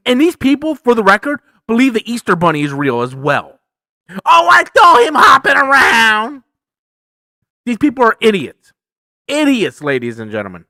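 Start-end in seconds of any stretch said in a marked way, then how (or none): 4.2-6.4 high, loud mock-excited shout; excited mock believer. Mocking believers of the Easter Bunny, excited shout.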